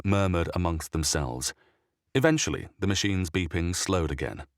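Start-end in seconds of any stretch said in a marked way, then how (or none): none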